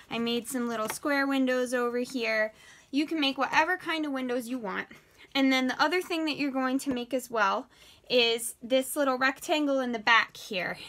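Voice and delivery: ordinary talk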